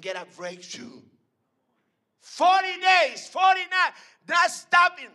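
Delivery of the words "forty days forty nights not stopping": preaching shout. Acted. Preacher thunders to the church, high and loud. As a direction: drive the words out at full force.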